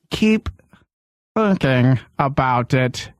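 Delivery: slow, drawn-out hint of a creepy hero; mocking how the radio hero talks, slow and drawn out; imitating the Shadow. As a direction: stretch thinking out, lightly mocking. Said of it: "he's all".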